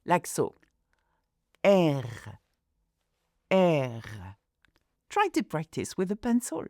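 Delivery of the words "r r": "R" made at the back of the throat, a guttural French R. Shown as a demonstration. Teacher demonstrates the French R with a pencil, throaty R. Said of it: "demonstrate how to say the R in French".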